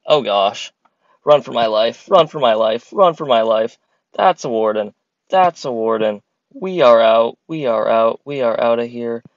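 loud panicked fleeing; frantic, running for your life; being chased. Real, not acted.

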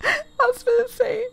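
high laughing, half giggle. Real, not acted. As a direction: giggle through it, embarrassed. Laughing at own dirty mind.

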